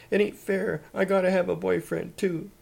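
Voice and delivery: high, loud wail of unfairness. A narrator's reading. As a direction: wail it out in protest. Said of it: "wailed Elsie".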